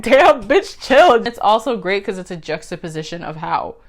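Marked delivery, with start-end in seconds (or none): 0.0-1.3 louder, higher exclaim at Batman; reacting to Batman flinging Harley, louder, higher; mock exclaim. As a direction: shout it at the screen, pitched up. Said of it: "I'm like".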